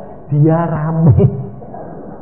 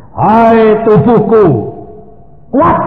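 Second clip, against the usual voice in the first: loud, slow, raised command; commanding own body; 6 semitones higher, 7 dB louder, slower than usual. Shown as a demonstration. Preacher demonstrates speaking to his sick body, loud command. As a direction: order your body to be strong, loudly. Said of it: "Saya praktek".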